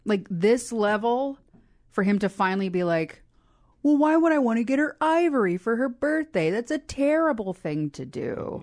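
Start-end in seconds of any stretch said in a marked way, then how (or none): 3.8-8.6 high-pitched, hammed-up indignant realisation; exaggerated dawning outrage, swooping high then settling; mock realisation. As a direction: imitate someone suddenly appalled by their own idea. Voicing Wheeler finally getting the point.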